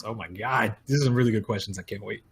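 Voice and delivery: normal talking voice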